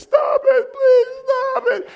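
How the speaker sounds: high, loud crying begging; terrified partner sliding down the seat, pleading; crying plea. Retold or imitated. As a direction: sob out please stop. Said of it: "He's down like this".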